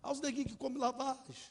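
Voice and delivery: high-pitched mocking taunt; high taunting call, not fully shouted; retold jeering shouts. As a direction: imitate kids jeering after someone. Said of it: "Eles gritando".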